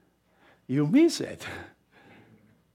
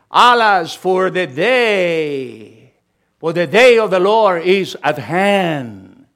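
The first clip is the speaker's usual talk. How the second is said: loud, very slow drawn-out lament; solemn proclamation; 7 dB louder, slower than usual. Real, not acted. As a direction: cry it out like a prophet's warning. Preacher reading prophecy aloud.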